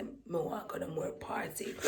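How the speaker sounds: whispered under the breath. Retold or imitated. Vlogger whispering what she never told her friends. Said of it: "me not tell them, say".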